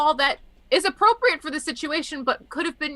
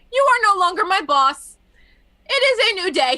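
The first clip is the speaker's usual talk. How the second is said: doctor's declaration; 8 semitones higher, 6 dB louder than usual. Retold or imitated.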